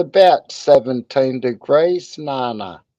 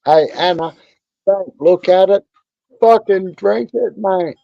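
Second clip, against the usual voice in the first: higher and louder, egging her on; rowdy urging; 5 semitones higher, 4 dB louder than usual. Real, not acted. Cheering on viewer Anna to drink up, loud rowdy call. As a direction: call out like a mate at the pub.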